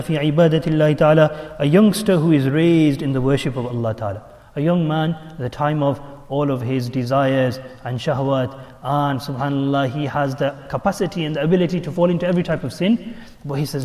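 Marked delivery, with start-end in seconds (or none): none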